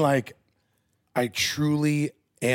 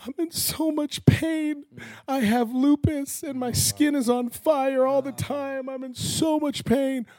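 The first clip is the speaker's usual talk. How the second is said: high, slow, tearful mock sob voice; imitating bawling; 13 semitones higher than usual. Retelling a woman in pain at a youth retreat, tearful high voice. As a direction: retell a crying woman's plea, pitched up and slow. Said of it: "And she's bawling".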